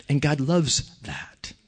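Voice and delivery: unvoiced hushed aside; whisper. Preacher confiding to congregation, whispered. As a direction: whisper it like a secret.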